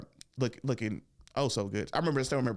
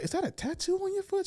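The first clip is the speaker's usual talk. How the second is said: much higher, eager mock curiosity; fake curiosity. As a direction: pretend to just be curious, pitched way up. Eager excuse to see feet.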